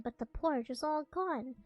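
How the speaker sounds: ordinary delivery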